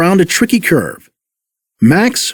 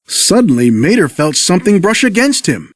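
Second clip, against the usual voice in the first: usual speaking voice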